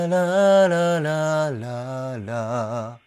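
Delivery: very slow la-la pushed into the nose; nasal resonance. Shown as a demonstration. Coach demos the yawn voice placed in the nose. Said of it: "この声を鼻に入れるんですよね".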